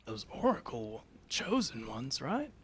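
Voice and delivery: hushed, confiding aside; softly muttered soldier's aside; stage whisper between soldiers. Acted. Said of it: "kind of whisper to each other".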